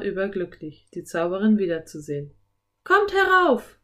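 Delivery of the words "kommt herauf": high, loud welcoming call; friendly call. A narrator's reading. Enchantress inviting the children up to the treehouse, high friendly call. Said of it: "rief sie den Kindern freundlich zu".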